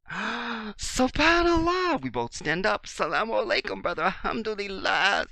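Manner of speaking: high, loud, drawn-out joyful exclamation; overjoyed greeting. Retold or imitated. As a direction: stretch each blessing in delight. Two Muslims greet each other.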